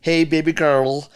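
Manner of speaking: slow, hammed-up Indian accent on "Hey, baby girl"; imitating Indian accent twang. Producer mimics singer's English, slow with an Indian accent twang. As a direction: sing the title slowly with a light Indian accent twang. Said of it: "it had a little bit of that twang".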